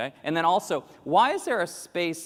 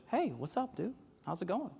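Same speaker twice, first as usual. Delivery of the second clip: high, soft laid-back dude; casual dude greeting; 6 semitones higher, 9 dB quieter than usual. Retold or imitated. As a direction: toss off a lazy hello. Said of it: "it's mostly just like".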